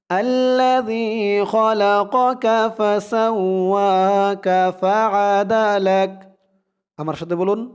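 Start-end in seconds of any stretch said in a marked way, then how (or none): 0.1-6.1 Quran recitation. Shown as a demonstration. Teacher reciting the verse for students to repeat, melodic chant. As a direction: chant each word long and even, like recitation.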